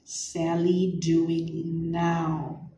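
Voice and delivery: slow repeat. Shown as a demonstration. Teacher repeats key words for class, very slow. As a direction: stretch each word out. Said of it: "It says".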